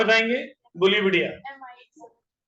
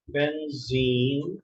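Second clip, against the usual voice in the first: very slow, syllables stretched out; dictating while writing; 3 semitones lower, much slower than usual. Real, not acted. Teacher writes compound name for class, slow drawn-out dictation. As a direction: draw out each word as you write.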